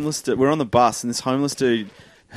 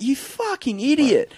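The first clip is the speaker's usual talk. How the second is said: exasperated; 14 semitones higher, slower than usual. Retold or imitated. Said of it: "I'm like".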